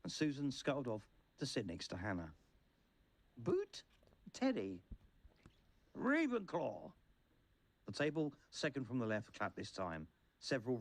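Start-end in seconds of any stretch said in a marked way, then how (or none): 3.4-4.8 high-pitched calling out of a name; surname pitched high, first name dropping; roll call. A narrator's reading. Teacher calling the roll of names. 5.9-6.9 high-pitched theatrical announcement; high cry falling away at the end; hat's shout. A narrator's reading. Hat announcing a house aloud.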